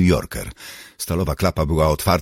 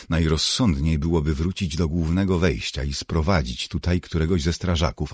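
ordinary delivery

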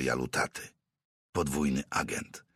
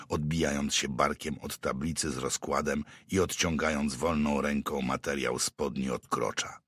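ordinary delivery, nothing marked

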